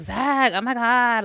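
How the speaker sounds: lightly voiced Marge-like Kata; imitating Kata. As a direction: drag it out like a cartoon mom. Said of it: "he was doing her voice".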